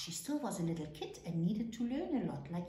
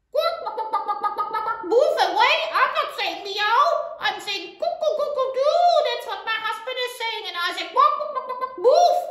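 loud, high squawking hen; mummy hen; 14 semitones higher, 15 dB louder, faster than usual. A narrator's reading. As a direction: cluck and shoo the kid away noisily. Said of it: "And Mummy says".

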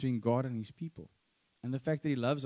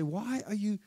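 raised, rebuking prophet's challenge; 6 semitones higher than usual. Retold or imitated. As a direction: call them out like a prophet. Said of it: "they use strong language".

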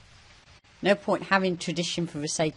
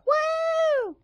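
very high, squealed "woo" imitation; mock over-excited whoop; 4 dB louder than usual. Player teasing teammate about their character, mock over-excited whoop. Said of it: "Your character sounds way too excited. She's like".

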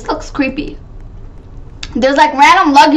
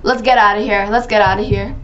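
ordinary delivery

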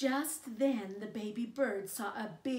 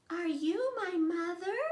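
much higher, hopeful chick voice; baby bird asking; 8 semitones higher, 7 dB louder than usual. Acted. Baby bird asking a kitten if it's his mother, high hopeful chick voice. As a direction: ask like a tiny lost chick. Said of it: "the baby bird asked a kitten".

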